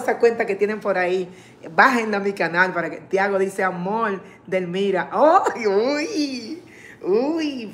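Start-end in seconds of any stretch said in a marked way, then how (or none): 5.1-7.7 surprised squeal. Real, not acted. Viewer's comment read aloud.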